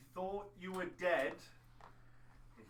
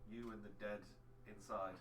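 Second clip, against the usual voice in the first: low quiet warning; 7 semitones lower, 11 dB quieter than usual. Acted. NPC warns in low voice.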